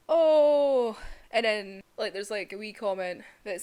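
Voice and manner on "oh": loud surprised oh. Real, not acted. Reacting to end.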